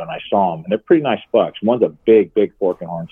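usual speaking voice

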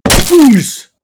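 high, loud startled cry; startled yelp. Acted. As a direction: jump in shock. Startled by the intruder.

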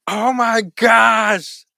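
drawn-out stunned oh my gosh; slow, high, overwhelmed exclamation; overwhelmed gasp. Real, not acted. Overwhelmed by the question.

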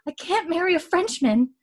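high sudden blurt; blurted line. Retold or imitated. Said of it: "Mary out of nowhere just goes".

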